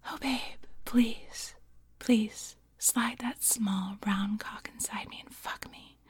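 soft, breathy whispered seduction; hushed pleading, heavy with breath; ASMR tone. Acted. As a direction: whisper intimately, gasping between phrases. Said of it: "both an A S M R tone".

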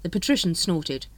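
plain reading voice

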